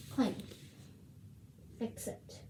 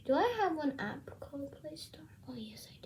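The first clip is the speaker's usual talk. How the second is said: whisper; 8 dB quieter, faster than usual. Real, not acted. Whispering to herself on camera.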